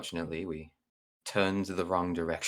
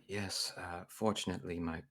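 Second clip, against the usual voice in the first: hushed, much softer voice; half-whispered; 8 dB quieter than usual. Acted. Gnome confiding about casualties in the mist, hushed. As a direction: confide it quietly, leaning in. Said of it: "I kinda just whisper".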